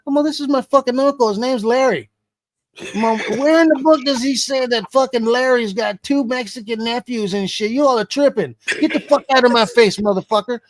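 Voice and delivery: high, fast angry rant; going off. Retold or imitated. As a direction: tear into the church people. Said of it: "I start going off on these people".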